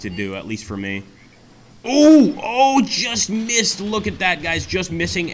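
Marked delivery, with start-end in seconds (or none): 1.8-5.3 disappointed cry. Real, not acted. Near miss in battle.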